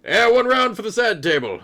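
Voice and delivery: high, loud holler ordering a round; boisterous tavern call; rowdy patron. Acted. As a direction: shout it across the bar. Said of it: "It's like".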